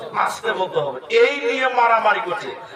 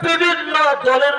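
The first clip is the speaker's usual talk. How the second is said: high, slow, drawn-out sermon chant; preaching at full force; 8 semitones higher than usual. Acted. Preacher chanting to the congregation, high and slow. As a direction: lift the voice and stretch each word to the crowd.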